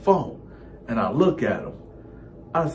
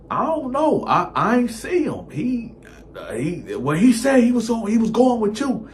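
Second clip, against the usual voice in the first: higher, flustered cover story; nervous stammer; 12 semitones higher, 4 dB louder than usual. Retold or imitated. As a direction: stumble through an obvious lie. Nervous lie to girlfriend.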